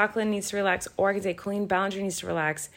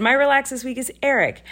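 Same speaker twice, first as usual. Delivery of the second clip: gleeful; 5 semitones higher, 6 dB louder than usual. Real, not acted. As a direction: read it out loud and triumphant. Reading a comment gleefully.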